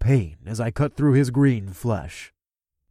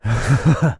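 gloating laugh; 5 dB louder than usual. Acted. Gloating over dying enemy.

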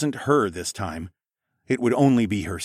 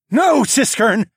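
loud, much higher, slow cry; shout; 14 semitones higher, 9 dB louder, slower than usual. Acted. Narrator stopping his friend confessing, shouting. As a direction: shout to stop him. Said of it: "I shouted".

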